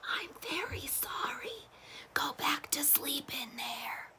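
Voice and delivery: soft high whisper. Acted. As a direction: whisper it gently, just breath. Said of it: "whispered softly".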